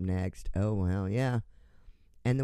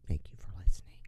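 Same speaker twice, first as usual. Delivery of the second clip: whispered thanks; 3 semitones lower, 4 dB quieter, much faster than usual. Real, not acted.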